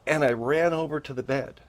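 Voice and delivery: normal talking voice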